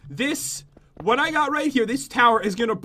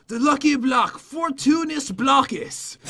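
dramatic reading; 4 semitones higher, slower than usual. Pretend. Reads book aloud dramatically.

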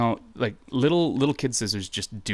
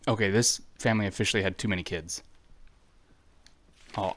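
ordinary delivery

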